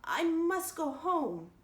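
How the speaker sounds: very slow, dull and stubborn; stupidly but determinedly. A narrator's reading. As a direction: insist flatly, half-dazed. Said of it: "repeated Diana stupidly but determinedly".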